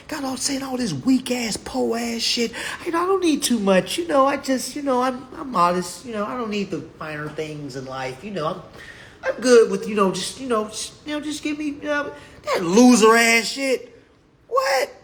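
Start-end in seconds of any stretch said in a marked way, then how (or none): 2.9-12.1 high, whiny humble-person impression; prissy meek voice, pitched up; mock humble voice. Mocking humble people who claim modesty.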